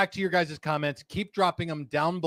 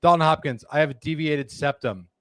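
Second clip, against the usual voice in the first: usual speaking voice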